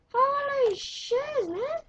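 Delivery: high, loud, slow drawn-out holy shiz; astonished cry; stunned exclamation. Real, not acted. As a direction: let the shock stretch out. Shocked in the game.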